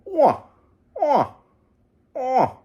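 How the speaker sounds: high, falling "Ooh-ah" calls, fading; moose call. Retold or imitated. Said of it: "You go".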